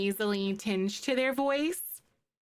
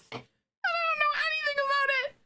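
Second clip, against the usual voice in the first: high voice breaking into laughter; laughing hard; 13 semitones higher than usual. Real, not acted. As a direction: talk through helpless laughter. Laughing at her own fun fact.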